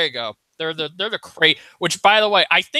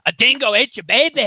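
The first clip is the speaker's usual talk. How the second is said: quick, louder, hammed-up Australian accent; mock Australian accent; 5 semitones higher, 4 dB louder, faster than usual. Fan quoting the Dingo meme in a mock Australian accent. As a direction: toss off the famous line in a jokey Australian accent. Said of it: "I know that Australian voice anywhere".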